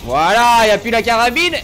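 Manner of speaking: high, loud proclamation; exultant announcing shout. Real, not acted. Streamer announcing the rifle is gone, loud shout. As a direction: shout it out for everyone to hear.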